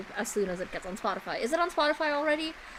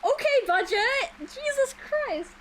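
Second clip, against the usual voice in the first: amazed outburst; 10 semitones higher than usual. Retold or imitated. An animation fan stunned by the cutscene budget, amazed high squeal. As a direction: pitch jumping way up in stunned disbelief. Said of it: "I was like".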